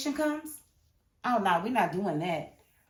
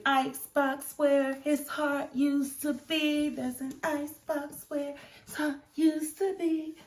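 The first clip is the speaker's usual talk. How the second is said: slow, higher, sing-song declaration; dramatic pronouncement; 6 semitones higher, slower than usual. Acted. Fan dramatises the boss's icy heart, sing-song and slow. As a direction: announce it slowly like a line of poetry.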